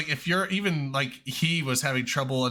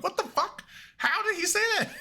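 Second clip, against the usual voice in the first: much higher, stunned laughing disbelief; high-pitched disbelief. Retold or imitated. Reacting to a translated thick accent, high disbelief. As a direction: squeak out shocked confusion. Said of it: "I'm like".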